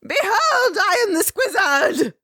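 very high, slow squeaky villain; villain proclamation. Retold or imitated. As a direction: announce yourself like a silly overlord. Villain announcing himself.

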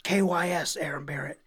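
hushed whisper of the hateful comment. Retold or imitated. Online trolls attacking the singer, in a whisper. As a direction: whisper it like a nasty secret. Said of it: "people being like".

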